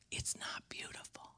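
barely audible whisper. Real, not acted. Preacher warning the congregation, whispered. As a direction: breathe the words, almost silent. Preacher hushes on key word.

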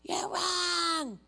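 mock scream. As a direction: imitate a scream without the full volume.

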